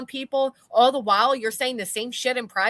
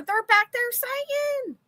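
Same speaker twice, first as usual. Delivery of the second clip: high drawn out; 13 semitones higher, much slower than usual. Real, not acted. Exasperated about mods.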